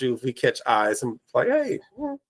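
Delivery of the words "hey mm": normal talking voice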